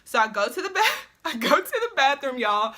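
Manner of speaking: ordinary talk, nothing marked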